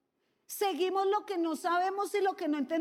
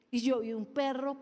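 ordinary talk, nothing marked

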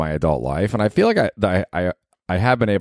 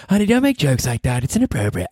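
higher, chiding partner voice; scolding girlfriend; 7 semitones higher than usual. Acted. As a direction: play the girlfriend telling him off. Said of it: "that little skit has ended".

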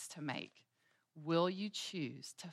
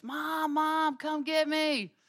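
put-on much higher child voice, calling out; child calling for mom; 13 semitones higher, 6 dB louder, much slower than usual. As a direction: call Mom like a toddler stuck in bed. Toddler Davis calling from bed.

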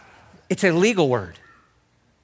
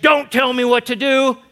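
defiant retort; 7 semitones higher, 5 dB louder than usual. Acted. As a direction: throw it back like a rebellious child. Same retort voiced again.

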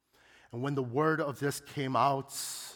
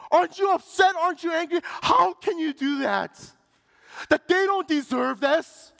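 high, loud, outraged shouting; screaming reporter; 10 dB louder than usual. Retold or imitated. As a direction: scream it at them in disbelief. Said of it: "he started screaming, yelling".

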